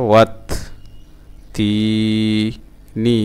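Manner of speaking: ওয়াত, তি, নি with long gaps; broken-up syllables. Shown as a demonstration. Teacher sounding out a word for learners, syllable by syllable. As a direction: hold তি and pause before নি. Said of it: "ভাঙা ভাঙা উচ্চারণ".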